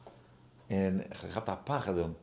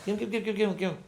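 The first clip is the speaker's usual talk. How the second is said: high-pitched string of quick repeats; urging along; 11 semitones higher than usual. Retold or imitated. Guide hurries the visitor along, high insistent urging. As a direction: mimic a guide beckoning in a high voice. Acting out a guide hurrying him.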